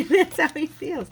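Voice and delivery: said through a real laugh. Real, not acted. Explaining her husband's attitude, laughing. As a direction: chuckle your way through it.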